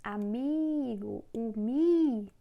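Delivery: aMIgo slowly, then MI alone drawn out; stress on middle syllable. Shown as a demonstration. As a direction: stretch the mi in amigo, then isolate it. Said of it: "tem maior força".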